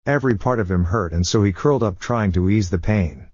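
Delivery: ordinary delivery, nothing marked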